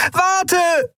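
very high, desperate shout; screaming. A narrator's reading. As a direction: shout after him to stop. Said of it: "schrie sie". (verzweifelter Schrei, sehr hoch)

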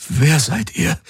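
he doesn't recognize his friends; frightened question. Acted. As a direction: ask in alarm. Frightened waking man.

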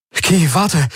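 high, urgent plea to wait; calling after her. Acted. Man calls after her as she runs out, urgent. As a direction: cry out to stop someone leaving.

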